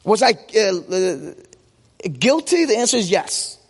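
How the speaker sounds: ordinary delivery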